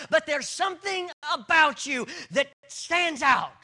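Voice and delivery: loud, climbing sermon delivery; preaching at full force. Acted. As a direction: drive the point home to the congregation.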